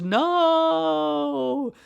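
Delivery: high, loud scream of despair. Retold or imitated. Vlogger acts the kid sliding away from Santa, a high screaming no. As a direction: wail it as you slide away. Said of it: "Ralphie just screams".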